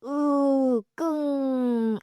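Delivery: high strained groan, dragged out slowly; pained groan. Shown as a demonstration. Teacher showing students what a groan sounds like, strained groan. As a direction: push out a groan like someone in pain. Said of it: "groan 하면은 제가 이제 예를 들어드릴게요".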